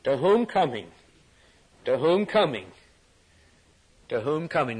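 WHOM leaned on, swelling then falling; emphatic "whom", lighter "coming"; stress on WHOM. Shown as a demonstration. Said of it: "the emphasis here, look at it".

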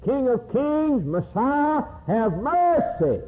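high, slow, stretched-out plea; sustained high preacher's cry; crying out for mercy. Acted. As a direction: call out like a beggar to a passing king. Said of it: "and cried".

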